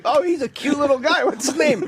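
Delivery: high doting coo over a stroller, half laughing; a passerby cooing at a baby in a stroller, high and amused; cooing stranger. Pretend. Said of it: "in the mall in a stroller like".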